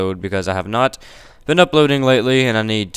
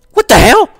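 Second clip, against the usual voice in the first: high, loud startled cry; 12 dB louder than usual. Real, not acted. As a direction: jump out of your skin. Scared by the game.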